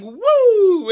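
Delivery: high loud "Woo!" whoop; clown whoop. Retold or imitated. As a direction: whoop like a clown's bib rolling up. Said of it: "the clown puts it on and it's like".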